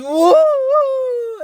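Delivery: loud, high, long "whoa"; mock falling yell. Storyteller voices Marcel thrown from a horse, mock yell. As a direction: imitate a wailing yell as he is thrown.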